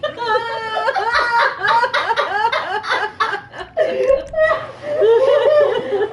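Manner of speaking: loud high cry-laughing; long helpless laugh. Real, not acted. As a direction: lose it completely, laughing. Laughing retold story.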